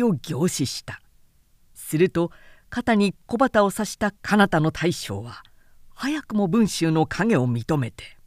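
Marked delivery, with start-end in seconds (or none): none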